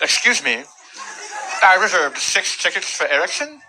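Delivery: polite customer with imitated speech impediment; cleft palate speech. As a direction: slur the s sounds as if with a cleft palate. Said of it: "A woman that also had a cleft palate".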